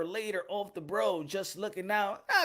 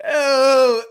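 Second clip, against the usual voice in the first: a big, loud "Oh" to kick things off; loud opening cry; 3 semitones higher, 15 dB louder than usual. Real, not acted. Host opens his stream with a loud cry. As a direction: belt out the greeting.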